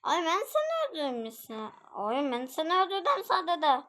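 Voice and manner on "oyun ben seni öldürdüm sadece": ordinary talk, nothing marked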